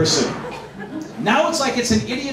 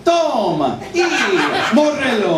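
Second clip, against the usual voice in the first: booming Spanish announcer; 8 semitones higher, 3 dB louder than usual. Pretend. Comic introducing himself to the crowd as a grand Spanish announcer. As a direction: boom out his own intro like a boxing announcer. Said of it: "I like to announce it like this".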